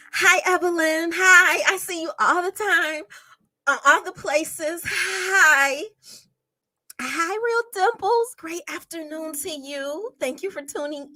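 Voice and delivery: excited hellos to viewers in the chat; bright greetings. Real, not acted. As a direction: call out each name like welcoming guests.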